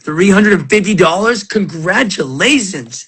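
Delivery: big, drawn-out celebratory cheer; rising hype-man congratulations; excited congratulations. Real, not acted. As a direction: hype it up slowly, word by word. Hyping a viewer's card find.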